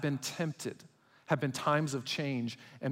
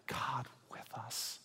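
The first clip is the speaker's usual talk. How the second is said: hushed whisper, slow; slow reverent whisper; 2 semitones lower, 12 dB quieter, slower than usual. Shown as a demonstration. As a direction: breathe it out in awe. Repeats phrase, first whispered.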